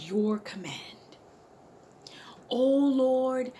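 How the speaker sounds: loud, pleading prayer, slow in places; impassioned shouted prayer; fervent prayer. Acted. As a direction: cry out to God for an answer. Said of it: "and prayed".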